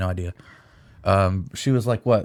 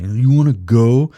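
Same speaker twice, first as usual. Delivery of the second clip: slow, louder taunting challenge; mock challenge; 4 semitones higher, 7 dB louder, slower than usual. Bob taunting Billy in game chat, slow loud dare. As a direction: drag it out, daring him. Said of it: "he'd be like".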